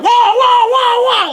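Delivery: very high, loud wailing siren; American siren imitation. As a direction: wail it like an American cop siren. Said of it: "our cop cars are more like".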